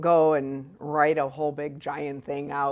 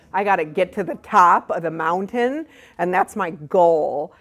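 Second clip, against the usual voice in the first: goal-obsessed past self; 8 dB louder than usual. Retold or imitated. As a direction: act out being fixated on the goal, hitting it loudly.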